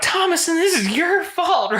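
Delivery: high-pitched accusing mother; shrill mother. Retold or imitated. High accusing mother to her daughter Thomason. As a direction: squeak out the blame like a frantic mom. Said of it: "Catherine comes out and is like".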